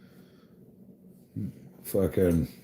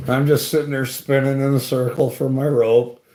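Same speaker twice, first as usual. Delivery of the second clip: ordinary delivery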